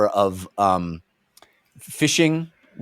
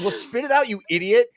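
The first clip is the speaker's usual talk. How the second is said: high exasperated scolding; 10 semitones higher than usual. Acted. Scolding the stoned character.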